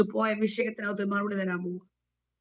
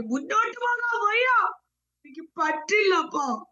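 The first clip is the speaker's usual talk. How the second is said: despairing believer; 9 semitones higher than usual. Retold or imitated. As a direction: cry out like a worn-out servant who gives up. Voices believer complaining to God. (തളർന്ന ഭക്തന്റെ ഉയർന്ന സ്വരത്തിലുള്ള പരാതി)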